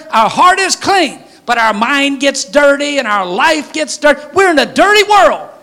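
rising fervent preacher; preaching climax. Real, not acted. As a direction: preach it out at full force.

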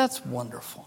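preacher quietly moved by a new believer; softly. Retold or imitated. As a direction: say it gently, almost to yourself. Quiet tender reply.

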